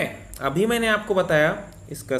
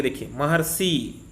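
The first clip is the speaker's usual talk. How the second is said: ordinary talk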